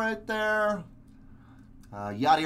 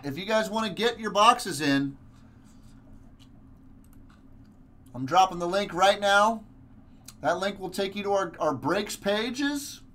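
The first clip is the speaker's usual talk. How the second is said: sales pitch; 5 semitones higher than usual. Acted. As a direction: sell it like a live host. Pitches the breaks, promo voice.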